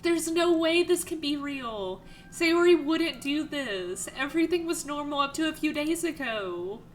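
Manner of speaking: high tearful voice through the lines; crying while reading. Real, not acted. Streamer reading the shocked lines aloud while still crying. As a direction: read it through real tears, half laughing. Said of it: "'cause I am actually crying".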